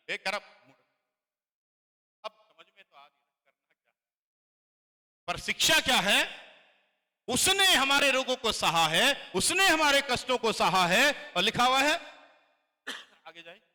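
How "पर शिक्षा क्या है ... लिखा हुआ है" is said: preaching at full force. Acted. Pastor preaching loudly to the church about suffering. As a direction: proclaim it to the congregation.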